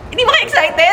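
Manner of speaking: high, loud hype question; rally the viewers excitedly. Real, not acted. Hyping the audience.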